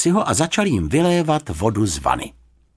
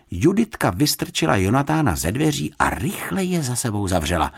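usual speaking voice

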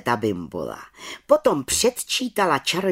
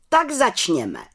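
raised, higher announcing call; chief witch calling out; 6 semitones higher, 3 dB louder, slower than usual. A narrator's reading. Chief witch opening the council, calling out. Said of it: "zvolala vrchní čarodějnice".

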